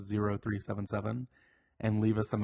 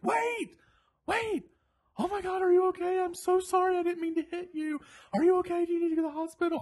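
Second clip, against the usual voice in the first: much higher, louder, frantic apologizing driver voice; panicked driver; 6 dB louder than usual. Retold or imitated. Driver who nearly hit the speaker begs forgiveness, frantic and much higher. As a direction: plead like a rattled man who nearly hit someone.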